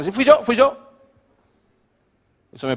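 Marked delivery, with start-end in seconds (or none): 0.0-0.8 louder, rising mock confession; humble owning up. Someone admits burning the eggs, hammed-up confession. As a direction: own up quickly and openly, voice rising. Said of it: "reconoce su punto de error".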